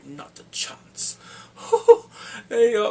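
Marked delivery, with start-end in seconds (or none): 0.0-1.2 whispered refusal. Retold or imitated. Royce refusing Littlefinger. 1.2-2.5 a gleeful burst of laughter; high breathy laugh. Real, not acted. Laughing at Littlefinger's fate.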